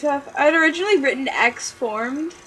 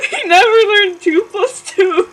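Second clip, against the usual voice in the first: laughing; 11 semitones higher, 10 dB louder than usual. Real, not acted. Reader laughing through line.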